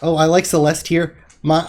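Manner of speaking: ordinary talk, nothing marked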